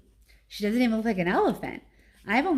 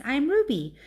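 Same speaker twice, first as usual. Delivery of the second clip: put-on lightly raised young voice; little elephant girl; 7 semitones higher, 4 dB louder than usual. A narrator's reading. Baby elephant introduces herself to Ivan, lightly raised child voice. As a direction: introduce yourself like a small child.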